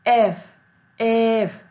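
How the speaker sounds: एफ said on its own, clear "ef"; letter sound demo. Shown as a demonstration. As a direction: hold the starting "e" of एफ. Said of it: "ए pronounce हो रहा है". (एफ की "ए" ध्वनि पर ध्यान)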